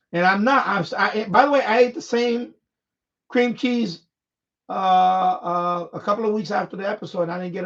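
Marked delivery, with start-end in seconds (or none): none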